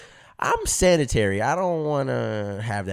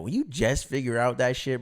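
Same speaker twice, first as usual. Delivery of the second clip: ordinary delivery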